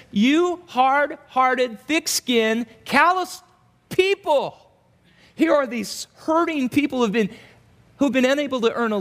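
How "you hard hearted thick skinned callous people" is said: slow rebuke. Acted. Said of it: "He says".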